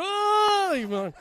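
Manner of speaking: a very high, drawn-out, loud oh of exasperation; a big groaning oh; exasperated oh. Acted. Hannah reacting to her husband's remark, with a huge high exasperated oh. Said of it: "I could just imagine her go".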